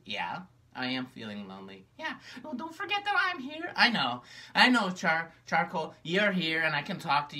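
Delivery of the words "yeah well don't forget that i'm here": very high, gentle puppet reassurance; caring high puppet. Acted. Charcoal reassures sad Magenta, very high and kind. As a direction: comfort her as a sweet little puppet.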